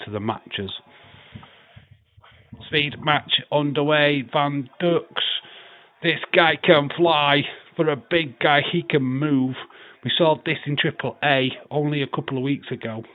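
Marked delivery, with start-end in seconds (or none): 2.7-13.0 excited play-by-play, higher and louder; live wrestling commentary. Acted. Streamer calls the wrestling match he's watching, excited play-by-play. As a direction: call the bout like a ringside commentator.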